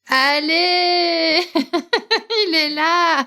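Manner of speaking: high laughing cheer; laughing delight. Real, not acted. Host reacting to the dog wallpaper, laughing and cheering.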